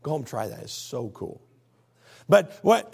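normal talking voice

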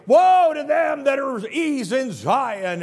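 loud, slow prophetic shout; thundering warning shout; prophet shouting woe. Retold or imitated. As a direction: cry out the woe like a prophet in the temple. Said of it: "Amos shows up and starts shouting".

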